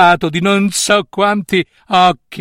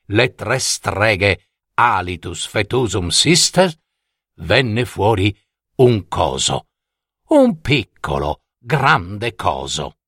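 narrazione misteriosa; 7 semitones lower, slower than usual. A narrator's reading. Narratore rallenta con mistero.

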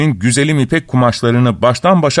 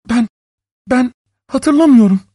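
high, faltering woman's voice; frightened wife; 12 semitones higher than usual. A narrator's reading. Wife cornered by her husband, high frightened stammer. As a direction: stammer the denial, pitched high.

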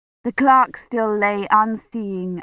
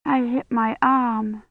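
slow, higher, bewildered voice; 4 semitones higher, slower than usual. A narrator's reading. Rosamond tells Kivrin of her injury, slow and bewildered. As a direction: report it slowly, a little lost. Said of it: "sounding bewildered".

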